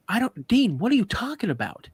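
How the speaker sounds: imitating dad's high-pitched, exasperated comeback; retold baffled denial. Dad answers accusing uncle back at camp, baffled protest.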